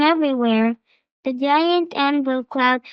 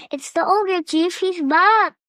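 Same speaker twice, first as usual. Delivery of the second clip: put-on higher, urgent child's plea; pleading boy; 5 semitones higher than usual. A narrator's reading. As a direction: beg the chief to listen. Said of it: "pleaded with the chief".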